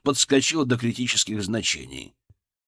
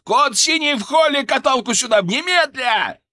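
high, loud, urgent command; full-lung shout; 13 semitones higher, 6 dB louder than usual. A narrator's reading. Doctor calls for a gurney, full-lung shout. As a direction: yell for help at once. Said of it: "заорал я во всю мощь лёгких".

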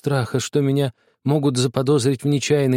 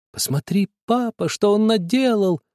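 high, complaining voice near tears; tearful complaint; 8 semitones higher than usual. A narrator's reading. Sister complains to father, tears in her voice. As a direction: whine to father, close to crying. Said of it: "жаловалась она со слезами в голосе".

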